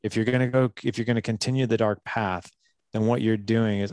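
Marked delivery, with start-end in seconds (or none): none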